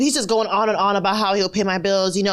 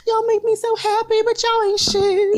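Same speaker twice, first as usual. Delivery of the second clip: mock lovesick declaration; 11 semitones higher than usual. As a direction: gush it like a lovestruck fool.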